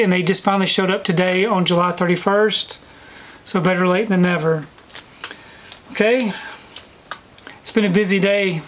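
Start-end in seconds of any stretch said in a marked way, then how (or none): 5.9-8.6 breathless and panting; out of breath. Real, not acted. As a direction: talk while catching your breath. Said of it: "I'm sorry I'm out of breath".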